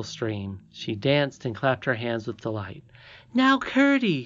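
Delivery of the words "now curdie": high, triumphant princess voice; very high, excited call; delighted cry. A narrator's reading. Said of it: "she cried".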